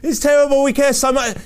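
high, gushing fake dismay; mock concern. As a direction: mimic people pretending to care so much. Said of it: "They want to sound like that".

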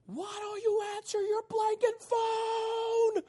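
high, slow, yelling mock voicemail; mock angry yell. Preacher imitating the girl's furious voicemail, high slow yell. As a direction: bellow it like an enraged voicemail. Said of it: "she's just like".

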